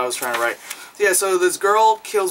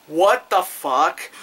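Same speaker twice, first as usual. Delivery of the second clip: slow disbelief; 3 semitones higher, 3 dB louder, much slower than usual. Real, not acted. Disbelief at a gross scene.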